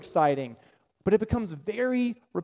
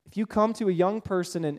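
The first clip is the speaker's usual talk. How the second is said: usual speaking voice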